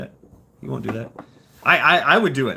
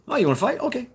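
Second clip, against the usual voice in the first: casual bravado; 10 semitones higher, much faster than usual. Retold or imitated. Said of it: "that's always been my mentality".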